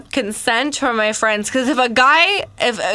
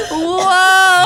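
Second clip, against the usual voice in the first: high laughing exclamation; laughing, cracking up; laughing whoa; 6 semitones higher, 4 dB louder than usual. Real, not acted. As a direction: laugh it out loud. Reacting to friend's joke.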